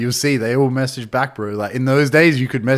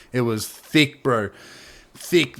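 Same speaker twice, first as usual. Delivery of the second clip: normal talking voice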